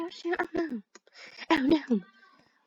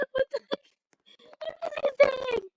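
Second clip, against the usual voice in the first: baffled; 3 dB quieter, faster than usual. Real, not acted.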